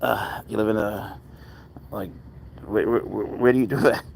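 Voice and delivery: ordinary talk, nothing marked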